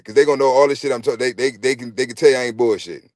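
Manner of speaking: normal talking voice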